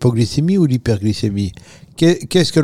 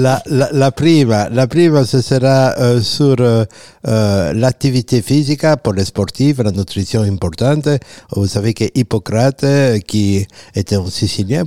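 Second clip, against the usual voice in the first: put-on thick Italian-accented French with hesitations; comic Southern Italian accent. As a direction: play the Sicilian expert speaking French. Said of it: "la touche, euh, sans accent".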